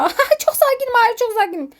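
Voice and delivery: high, laughing near-panic; failed calm. Real, not acted. Player insisting he is calm while panicking, high and laughing.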